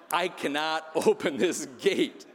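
"I cannot open this gate" slow and deliberate, each word hit; emphatic refusal. Acted. Guard refuses the sergeant, "cannot" firmly punched, slow.

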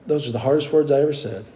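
ordinary delivery

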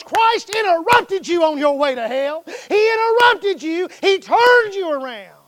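loud, high preaching cry; preaching at full force. Acted.

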